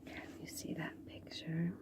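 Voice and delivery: hushed whisper so the lion sleeps on. Acted. Storyteller hushing the children beside the sleeping lion, whispering. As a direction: breathe the question softly.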